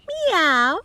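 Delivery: high mewing cat sound; cat meow. Acted. Mother cat meows offstage.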